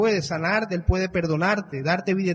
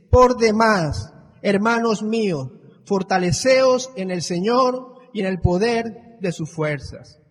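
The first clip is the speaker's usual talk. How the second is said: solemn scripture reading; 5 dB louder, slower than usual. Acted. Reads scripture aloud to congregation.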